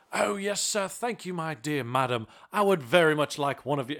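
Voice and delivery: put-on high, posh-sounding English accent placing an order; overly polite English accent, a put-on. Ordering at a drive-thru in a put-on English accent. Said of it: "order in an English accent".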